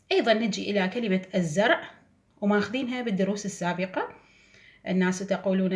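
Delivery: normal talking voice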